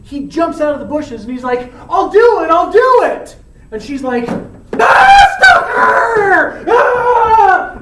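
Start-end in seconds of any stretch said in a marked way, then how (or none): 1.9-3.4 eager shout. Acted. Romeo leaps from the bushes. 4.8-7.8 startled scream. Acted. Juliet startled by a stalker.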